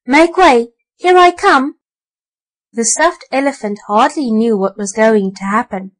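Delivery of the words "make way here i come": loud, higher calling out; harsh call. A narrator's reading. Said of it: "the harsh voice had called".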